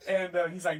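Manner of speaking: ordinary talk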